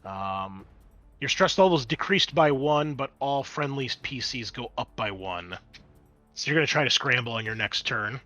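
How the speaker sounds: ordinary delivery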